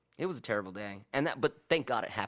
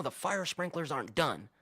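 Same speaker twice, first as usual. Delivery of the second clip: higher-pitched mock ranting; agitated complaint, higher but not shouted; imitated raised voice; 6 semitones higher than usual. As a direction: imitate someone getting worked up, pitched up. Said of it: "he's raising his voice".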